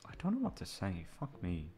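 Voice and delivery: ordinary delivery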